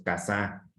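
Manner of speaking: "casá" said softly as ca-SÁ, weight on the end; stress on final Á. Shown as a demonstration. Teacher dismissing a word that does not exist, stress on final Á. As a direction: stress the last syllable of "casá". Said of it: "Si lo pongo en la segunda A".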